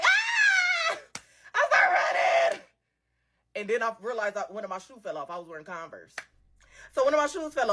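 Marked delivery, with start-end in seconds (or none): 0.0-2.6 high, crying shout; frightened cry. Retold or imitated. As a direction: wail it out while running in panic. Running from shooting, crying out.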